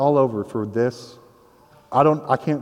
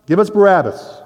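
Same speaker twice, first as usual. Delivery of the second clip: a crowd yells its refusal, higher and louder; crowd shouting back; 6 semitones higher, 4 dB louder than usual. Retold or imitated. Preacher voicing the crowd rejecting Jesus, shouting. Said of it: "They shouted back".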